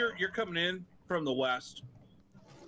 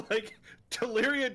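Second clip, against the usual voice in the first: laughing; 7 semitones higher than usual. Real, not acted. DM laughing while retelling.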